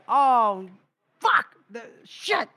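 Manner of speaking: high, gasped mock-panic swearing; freaking out. They see who the soldiers caught, freaking out. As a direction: curse in rising acted panic, word by word. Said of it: "now they're freaking out".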